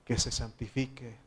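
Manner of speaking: loud, pleading prayer to the Lord; fervent prayer. Acted. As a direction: pray out loud with urgency. Pastor leads closing prayer.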